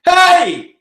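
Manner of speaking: big, high, loud shout; yelling. Shown as a demonstration. Singing teacher demonstrating the big sound of yelling, a loud yell. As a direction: belt out a sudden HEY with a big strong sound. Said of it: "Sometimes when you are yelling".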